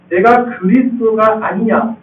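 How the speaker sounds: usual speaking voice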